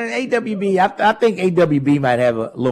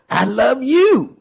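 higher, slow doting parody; gushing mock declaration; 8 semitones higher, slower than usual. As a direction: drag it out like a fawning admirer. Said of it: "runs over to Donald Trump and says".

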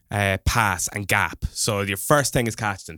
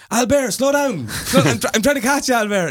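high-pitched call to someone ahead; retold shout; 14 semitones higher, 5 dB louder than usual. Runner shouting at the man he is chasing, high mock shout. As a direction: re-enact shouting after another runner. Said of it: "I was shouting at him".

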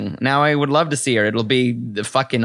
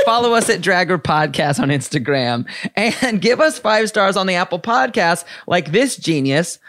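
ordinary delivery

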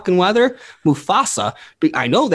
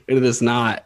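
ordinary delivery

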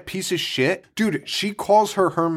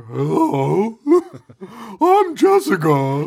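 bad high girly voice, slow; hammy fake girl voice; man doing a woman's voice; 7 semitones higher, much slower than usual. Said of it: "it's the worst girl voice".

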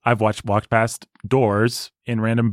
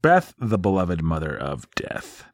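slow, lower, ominous announcement; mock-solemn title; 5 semitones lower, 3 dB quieter, slower than usual. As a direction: intone it slowly like a grim title.

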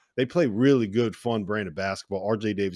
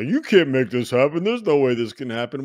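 mock doubting co-host; 4 semitones higher, 5 dB louder than usual. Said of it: "Randolph is gonna say".

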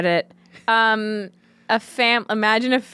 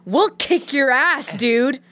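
tough guy threat; 5 semitones higher, slower than usual. Pretend. Acted Arby's staff threatening customer.

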